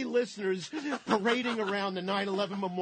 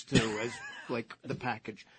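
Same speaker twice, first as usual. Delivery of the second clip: normal talking voice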